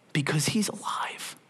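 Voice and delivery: whisper. Real, not acted. Preacher whispers the climax.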